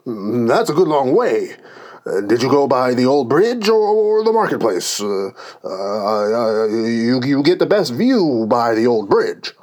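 stammering, quivering king voice; nervous quiver. A narrator's reading. King distracts the quarrelling pair, nervous and quivering. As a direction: dither nervously with a wavering pitch. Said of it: "his voice quite quivered".

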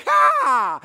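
a loud, high "Ha!" like a karate chop; sharp shout. Acted. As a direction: bark it out like a chop. Karate chop the Red Sea.

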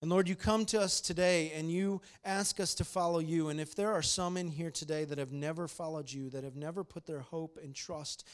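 earnest prayer to God, opening loud then settling; closing prayer. Acted. Pastor leading the congregation in closing prayer, earnest. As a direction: pray sincerely for the room.

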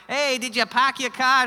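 a Midwest relative hamming up a bad Boston accent; fake Boston accent. Family back home mocking him in a fake Boston accent. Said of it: "bad, fake Boston accents".

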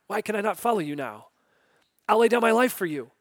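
quick, eager pleading; fast, eager promise; impatient Peter. Retold or imitated. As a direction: rush the words as impatient Peter. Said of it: "Peter's just impatient".

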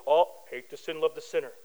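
low, soft mock-pious aside; mock piety. Preacher mimicking hypocritical Christians to his congregation, mock-pious. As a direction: toss off the slogan with hollow piety. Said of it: "We talk mad trash on people".

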